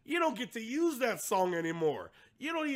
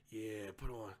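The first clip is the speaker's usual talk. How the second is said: low, hushed creep voice; quiet imitation of a pervy viewer; mock creepy viewer; 12 semitones lower, 13 dB quieter than usual. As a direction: mutter it low and soft like a leering man. Imitating man watching makeup videos.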